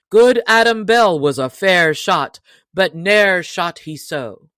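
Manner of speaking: loud, challenging yeoman voice; proud stranger. Acted. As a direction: throw the boast back at him. Stranger pledges himself to Robin.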